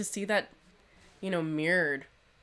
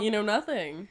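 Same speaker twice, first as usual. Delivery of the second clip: teasing; 5 semitones higher, 5 dB louder than usual. Acted. Teasing friend, laughing.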